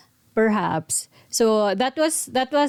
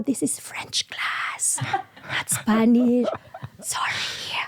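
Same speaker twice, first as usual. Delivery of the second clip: slow, hushed, half-whispered teacher; imitating teacher; 3 dB quieter, slower than usual. Teacher telling the student she is in French class, slow and hushed. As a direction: say it slow and breathy like a polite teacher. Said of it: "she was like".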